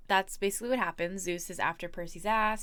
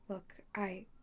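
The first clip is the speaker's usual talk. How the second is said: hesitant breathy whisper; faltering whisper; 7 dB quieter than usual. Acted. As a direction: trail off unsure. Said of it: "her voice faltered".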